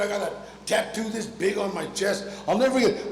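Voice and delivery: ordinary talk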